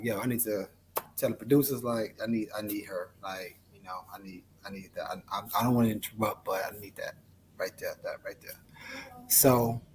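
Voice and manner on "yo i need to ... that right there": hushed aside. Retold or imitated. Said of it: "I'm like".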